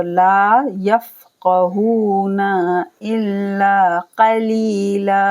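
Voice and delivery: slow chant stretching the long vowels; Quran recitation. Shown as a demonstration. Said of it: "teacher recites verse".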